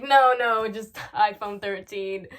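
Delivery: loud start breaking into slow laughing words; pretend amusement. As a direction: fake being cracked up.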